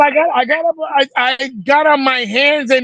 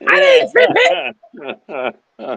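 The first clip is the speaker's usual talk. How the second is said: daughter's innocent denial. Retold or imitated. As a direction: play the innocent kid denying it. Daughter voiced denying to mom.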